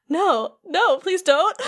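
high, mildly acted plea; frightened pleading. Replica Riku stepping back from Vexen's card, frightened pleading. Acting boy backing away in fear.

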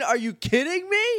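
high, incredulous outburst; can't believe what she's seeing; squeaky high are-you-kidding-me; shocked disbelief. Real, not acted. Reacting to Heather's arrival.

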